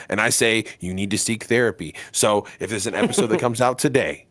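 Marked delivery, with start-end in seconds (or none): none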